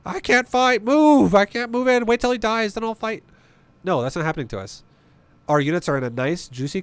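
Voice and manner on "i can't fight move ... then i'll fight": high whiny voice of a stupid unit; dumb unit voice. Retold or imitated. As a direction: whine like a clueless unit.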